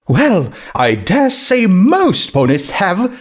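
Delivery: high, grand and gracious; Rarity voice. Acted. Rarity greeting the ghost grandly in a high voice. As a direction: preen a little, lifting the voice high.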